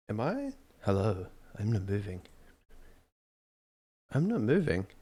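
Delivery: usual speaking voice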